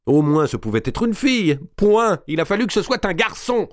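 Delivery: high, loud furious tirade; angry rant. Acted. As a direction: spit out the rage at the birth. Mao raging to Béatrice.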